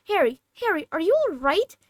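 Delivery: high, loud anxious calling; worried call. Acted. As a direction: urgently wake him up. Friend shaking Harry awake.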